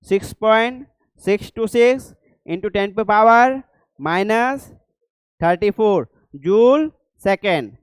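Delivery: slow dictation. Shown as a demonstration. Teacher dictating Planck's constant.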